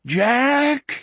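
high, drawn-out howled name. A narrator's reading. Wolf held down, calling his friend, howling. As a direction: howl the name out long and high. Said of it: "he howled".

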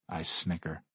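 plain reading voice

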